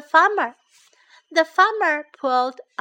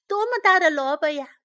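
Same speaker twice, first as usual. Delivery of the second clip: high, quick, raised exclamation; amazed yell; 5 semitones higher, 5 dB louder, faster than usual. A narrator's reading. Everyone seeing the turnip out at last, excited cry. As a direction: cry out in wonder at the size. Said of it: "大家都叫喊了起来".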